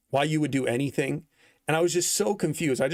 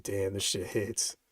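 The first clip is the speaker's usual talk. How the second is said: hushed awe; 5 semitones lower, 7 dB quieter, slower than usual. Retold or imitated. Awed by song on trip.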